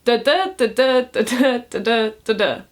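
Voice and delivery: "da-da, da-da" chanted with stress on every second beat; iambic rhythm da-DA. Shown as a demonstration. Poet demonstrating iambic pentameter to viewers, rhythmic chant. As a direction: beat out "da-da" five times like a metronome. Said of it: "so ten syllables where five are stressed".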